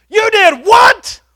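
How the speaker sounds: high, slow furious shout; outraged. Retold or imitated. Car owner yelling at the kid who hit his car, outraged. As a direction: yell it in disbelief at the kid.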